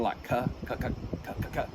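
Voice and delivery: soft, sparse "cuh, cuh"; quiet close-in feed; realistic feed call. Shown as a demonstration. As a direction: mimic a duck picking up rice. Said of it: "your realistic feeding".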